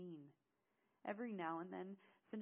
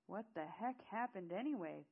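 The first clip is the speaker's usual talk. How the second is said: talking through a bite; loud question round a mouthful; mouth full; 3 semitones higher, 7 dB louder than usual. A narrator's reading. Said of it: "through a mouthful of food".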